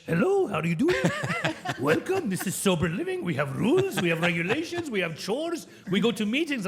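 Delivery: lightly voiced Persian-accented Yoda; a hint of a Yoda-like sage with Persian accent; Siamak as Persian Yoda. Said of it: "He even sounded like Yoda with a Persian accent".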